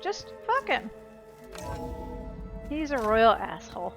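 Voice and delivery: normal talking voice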